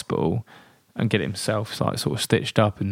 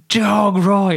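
high, loud alarm yell; full shout; 11 semitones higher, 6 dB louder than usual. Retold or imitated. Cyclist yelling at the team as the dog charges. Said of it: "as I've shouted".

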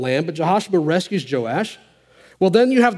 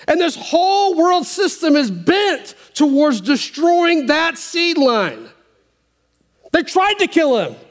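higher, swelling preacher's cadence; preaching; 10 semitones higher than usual. Acted. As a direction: build the sermon to a fervent peak. Preaching at full force.

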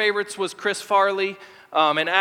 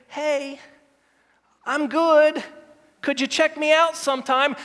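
casual god; 4 semitones higher than usual. Pretend. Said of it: "This isn't God saying".

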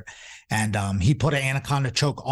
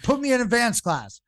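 high-pitched imitation of an impatient beginner; mock eager demand; 12 semitones higher than usual. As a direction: demand it in a raised, pushy pitch. Imitates his eager younger self demanding.

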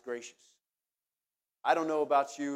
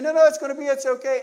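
soothing friends; 10 semitones higher, 13 dB louder than usual. Retold or imitated. Friends blocking the door.

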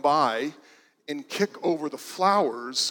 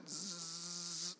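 mosquito buzz; 2 semitones higher, 20 dB quieter than usual. Shown as a demonstration. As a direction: buzz like a tiny mosquito, barely audible. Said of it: "that sounds like a mosquito".

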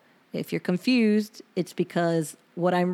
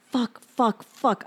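exasperated swearing; 4 semitones higher, slower than usual. Retold or imitated. Reading her angry journal entry.

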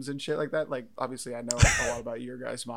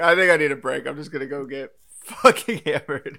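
laughing; 11 dB louder than usual. Pretend. Jokingly voicing himself wanting break.

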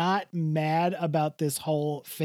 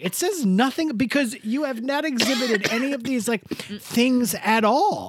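higher-pitched, exasperated protest; exasperated retelling; 7 semitones higher than usual. Retold or imitated. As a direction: act out throwing your hands up at the question. Acting out her exasperated answer.

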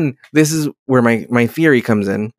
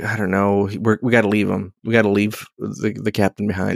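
quiet quote; 6 semitones lower, 6 dB quieter than usual. Retold or imitated. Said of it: "they're like".

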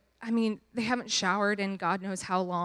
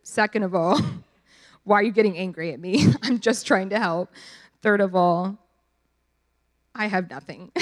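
inner rant; 7 dB louder than usual. Retold or imitated. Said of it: "in my head, I was like".

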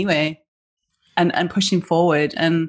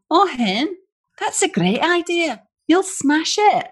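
much higher, slow Scottish encouragement; Scottish accent; 12 semitones higher, slower than usual. Retold or imitated. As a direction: cheer her on like a broad Scottish best friend. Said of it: "Oh, she's Scottish".

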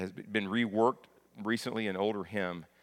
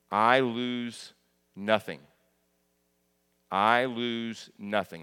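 I LOSE NOTHING slow and spaced out, each word weighted; slow, deliberate stress on every word of "I lose nothing"; emphatic repetition; 4 dB louder, much slower than usual. Real, not acted. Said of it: "Let me emphasize that".

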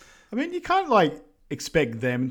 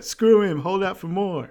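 higher, louder mock yell; kids yelling; 7 semitones higher, 5 dB louder than usual. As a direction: shout it out like a class of kids. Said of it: "the kids just yell".

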